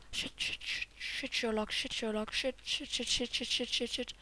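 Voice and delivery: soft, repetitive muttering; hushed string of panicky swearing; nervous cursing. Real, not acted. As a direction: mutter it over and over under your breath.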